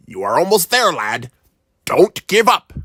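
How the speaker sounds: pirate voice urging the lad on; pirate encouragement. A narrator's reading. Pirate urging the boy to keep digging, pirate voice. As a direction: cheer him on like an old sea dog.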